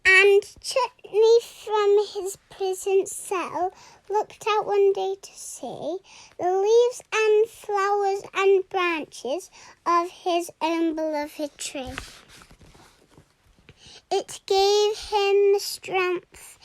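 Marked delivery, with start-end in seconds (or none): none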